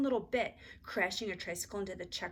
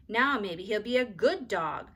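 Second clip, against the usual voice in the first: sulky; 2 semitones higher, 7 dB louder, slower than usual. A narrator's reading. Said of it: "said Ramona sulkily".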